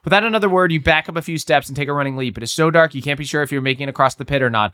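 story narration. A narrator's reading. Reads the gamebook aloud.

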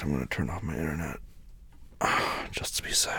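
quiet mutter. Acted.

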